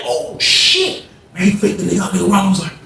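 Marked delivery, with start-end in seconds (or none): 0.0-1.0 shocked exclamation. Retold or imitated. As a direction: pitch leaps way up on oh shit. Narrator's shocked reaction to fake.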